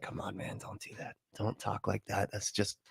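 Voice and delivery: very soft, low aside; hushed pleading. Pretend. Viewer quietly begging the preacher to stop, hushed. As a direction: plead under your breath.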